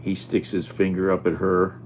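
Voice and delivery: usual speaking voice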